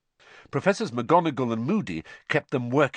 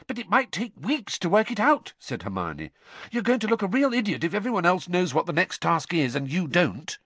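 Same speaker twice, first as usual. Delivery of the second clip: nagging; 9 semitones higher than usual. A narrator's reading. Hermione nags Harry.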